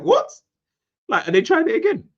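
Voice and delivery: very high, loud disbelief; acting out a stunned fan; incredulous outburst. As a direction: squeal it in sheer disbelief. Acting out his disbelief at the goal.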